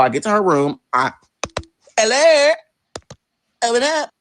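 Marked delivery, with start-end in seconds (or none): 2.0-4.1 high, loud call through a door. Retold or imitated. At sister's hotel room door, calling out. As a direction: raise the pitch and demand an answer.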